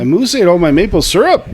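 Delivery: loud, high mock Canadian accent. Friend takes a turn at the Canadian accent contest on the podcast, hammed-up Canadian accent. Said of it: "Canadian time".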